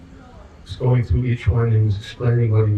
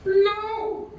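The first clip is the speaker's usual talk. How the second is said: retold scream; 4 dB quieter than usual. Devotee protesting at the board, imitated high-pitched scream. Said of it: "he started screaming".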